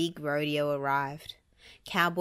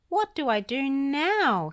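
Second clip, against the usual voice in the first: high, slow panicked wail; cling on and cry for help; 8 semitones higher, 5 dB louder, slower than usual. A narrator's reading. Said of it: "he cried".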